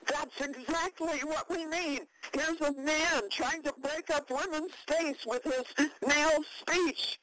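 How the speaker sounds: high-pitched mock screaming accusation; imitated screaming. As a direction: imitate an outraged crowd, pitched well up. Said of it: "pointing at me and screaming".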